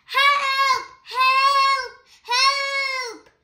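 high, loud cries of help; screaming for help. Acted. Mr and Mrs Watson call out as their floor cracks, high screams for help. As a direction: yell it out as if trapped in danger. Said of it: "scream like".

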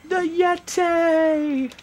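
high, drawn-out mock announcer bellow; hammed-up announcer cry. Player hyping the Yeti's match, hammed-up drawn-out cry. Mimics a hyped wrestling announcement.

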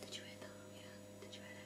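nervous night vlog whisper. Real, not acted. Whispering after hearing noise.

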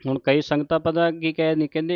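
ordinary talk, nothing marked

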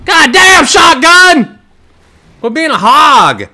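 loud, high exasperated shouting; real outburst at a kill-stealing teammate; indignant yell. Real, not acted. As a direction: scold the teammate hard.

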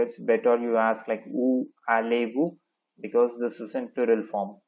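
ordinary talk, nothing marked

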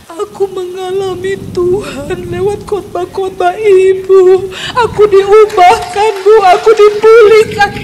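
sobbing loud and slow, voice breaking; loud tearful confession, half acted; crying maid. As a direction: cry the words out through tears. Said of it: "langsung nangis, termehek-mehek".

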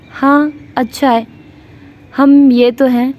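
coy and embarrassed, smiling through it; a shy bride-to-be showing off her fiancé; shy smile. A narrator's reading. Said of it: "शर्मीली मुस्कुराहट सजाए के बोली".

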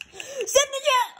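high, stretched-out excited yell. Real, not acted. Gamer urging player toward the net, excited yell. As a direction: shout it high as the chance opens up. Calls player on a scoring chance.

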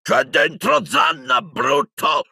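high, slow roar of protest; outraged yell. A narrator's reading. Hagrid yelling at the one who burned his hut. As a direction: shout it in outrage, word by word. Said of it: "urlò Hagrid".

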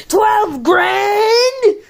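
high shocked cry at the price; shocked outcry. Pretend. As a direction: gasp it out in disbelief.

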